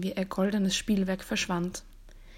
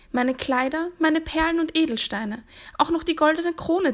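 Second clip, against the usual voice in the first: higher-pitched, pleading offer; eager princess; 7 semitones higher than usual. A narrator's reading. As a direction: list the treasures in a raised, coaxing princess voice. Princess offers her treasures.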